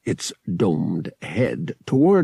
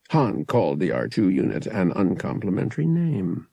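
plain reading voice

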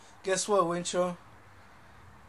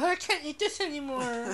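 whiny wimp; 8 semitones higher than usual. Acted. Host mocking squeamish listeners, whiny and high. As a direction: put on a high-pitched wimp complaining. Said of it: "if they're a little bitch".

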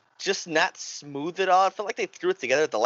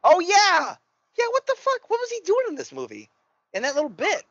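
excited recognition; 4 dB louder than usual. Real, not acted. Recognizing Dick Miller's cameo.

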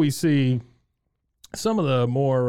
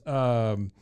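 ordinary delivery

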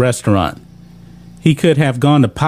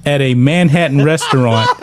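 ordinary delivery

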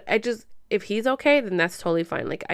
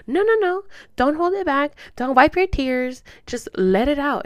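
high, loud therapist insisting; imitated urging; 7 semitones higher, 4 dB louder than usual. Therapist stopping client holding back tears, insistent urging. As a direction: urge it firmly, higher and louder.

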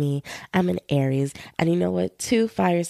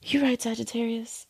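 soft agreement; 5 semitones higher, 6 dB quieter than usual. Retold or imitated. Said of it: "And I'm like".

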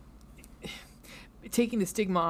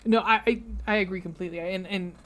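usual speaking voice

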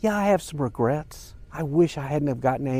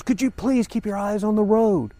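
mild mock outcry, higher pitched; retold cry; 6 semitones higher than usual. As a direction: plead in exasperation, imitating her. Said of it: "she cried out".

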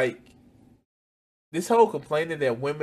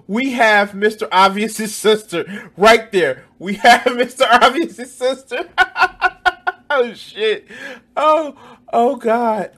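laughing hard, high and loud; high loud laughter; 9 semitones higher, 11 dB louder, slower than usual. Real, not acted. Mocking the woman in the video, laughing loudly. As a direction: howl with laughter at the clip. Mocking Mister Obvious's sister.

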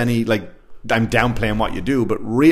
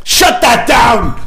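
high, loud, slow mock shout; hammed-up yell to shut the game down; 9 semitones higher, 5 dB louder, much slower than usual. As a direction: bark it like a killjoy at a bar. Imitating himself yelling at bar.

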